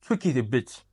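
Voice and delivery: normal talking voice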